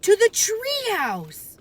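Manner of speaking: high, slow desperate shout; urgent cry. A narrator's reading. Boy calling his sister to the treehouse, a frightened cry. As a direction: call out the escape route in fear. Fleeing the dinosaur.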